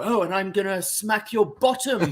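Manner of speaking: mock posh villain. Mocking a posh British wrestling heel with a lightly prissy voice. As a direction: camp up a toffee-nosed villain. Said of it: "They're not gonna go".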